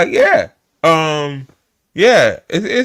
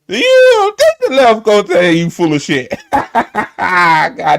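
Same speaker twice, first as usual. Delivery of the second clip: laughing; 4 semitones higher, 4 dB louder, slower than usual. Real, not acted. Laughing at Laura Loomer quote.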